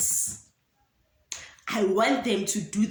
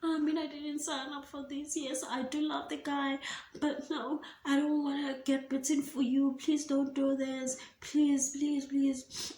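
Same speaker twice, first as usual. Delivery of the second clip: put-on high, whiny pleading kid voice; mock the kids begging in a high pitch; playful impression of a whimpering kid; imitating crying kids; 7 semitones higher than usual. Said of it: "all the kids started crying".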